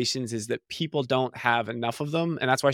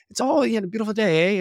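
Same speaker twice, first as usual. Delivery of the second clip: lightly lilting, higher Canadian accent; subtle Canadian eh; 8 semitones higher, faster than usual. Shown as a demonstration. Showing the host real Canadian speech, subtle Canadian accent. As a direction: slip a gentle Canadian "eh" on the end. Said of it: "It's just subtle and it's nice".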